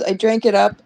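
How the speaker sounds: ordinary talk, nothing marked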